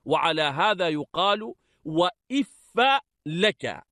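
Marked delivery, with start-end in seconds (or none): 1.9-3.8 وإف as "iffa", opening i, final a; kasra then fatha. Shown as a demonstration. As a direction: say وإف لك carefully as "wa-if-fa laka". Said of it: "بكسر الألف وفتح الفاء".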